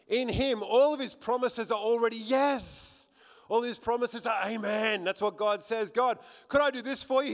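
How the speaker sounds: loud, high, rousing sermon delivery; full-force preaching. Real, not acted. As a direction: proclaim it to the whole congregation, punching the affirmations.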